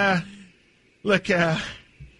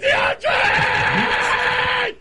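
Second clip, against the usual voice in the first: a wailing last plea; desperate high yell; 13 semitones higher, much slower than usual. Acted. Owner wailing the star's name into voicemail.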